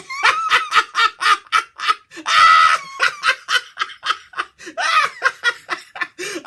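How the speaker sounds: loud, long howling laugh. Real, not acted. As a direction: lose it completely. Laughing at chicken toothpaste.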